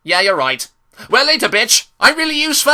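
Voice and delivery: high, loud mock-cheery boast; hammed-up high cartoon voice; voiced cartoon character. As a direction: send up an engine, climbing to a loud high finish. Mock dialogue of episode characters.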